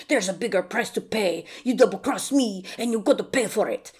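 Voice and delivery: swindler's threat in a mock French accent; bad French accent. Swindler warning whoever betrays him, mock French accent. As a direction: put on a rough French accent, menacingly. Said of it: "I make him have a French accent".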